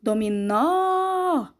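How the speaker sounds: "Dominó" with a long, wide-open final NÓ; stressed open vowel. Shown as a demonstration. Teacher shows the strongest syllable, NÓ held open in "Dominó". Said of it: "eu abro muito bem a minha boca".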